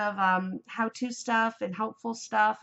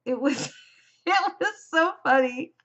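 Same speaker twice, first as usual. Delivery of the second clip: real helpless laughter, pitched up; laughing hard; 5 semitones higher than usual. Real, not acted. Vlogger recalls a hilarious knitting episode, laughing hard. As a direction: barely get the words out for laughing. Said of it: "I laughed so hard".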